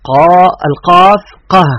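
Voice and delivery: slow, loud قا, then قه closed on an h; letter sound demonstration. Shown as a demonstration. Teacher demonstrating the letter's sound, slow and loud. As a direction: sound out قا and قه emphatically. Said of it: "لماذا يأتي بالهاء؟".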